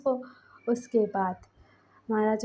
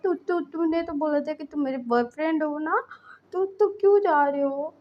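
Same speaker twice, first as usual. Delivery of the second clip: put-on high, slurring drunken plea with stammered repeats; drunk-sounding; 5 semitones higher than usual. A narrator's reading. Lancen begging Wing not to leave, drunk and high. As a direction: sound tipsy and clingy, voice pitched up. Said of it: "जैसे वो drunk हो".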